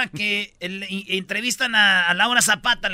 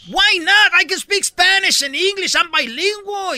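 high, loud indignant English; angry daughter; 11 semitones higher, 8 dB louder, slower than usual. Retold or imitated. Angry daughter answers the man telling her not to speak Spanish. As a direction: snap back in English, fired up. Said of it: "se encanija la morra".

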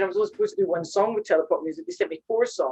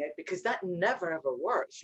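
normal talking voice

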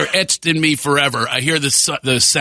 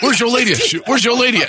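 teasing taunt; 7 semitones higher, faster than usual. Retold or imitated. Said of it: "it's like a loop".

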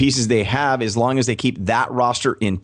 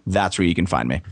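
usual speaking voice

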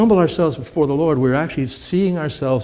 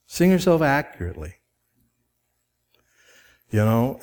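ordinary talk, nothing marked

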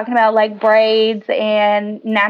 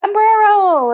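mock drunk party cheer; 9 semitones higher, much slower than usual. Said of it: "and they're like".

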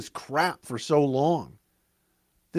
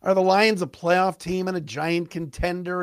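normal talking voice

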